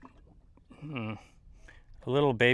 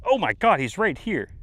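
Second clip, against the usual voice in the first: excited; 6 semitones higher, 8 dB louder than usual. Real, not acted. Sudden shark sighting, excited.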